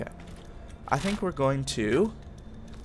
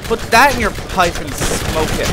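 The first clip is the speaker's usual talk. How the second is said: loud taunt; 3 semitones higher, 7 dB louder than usual. Real, not acted. Taunting the enemy.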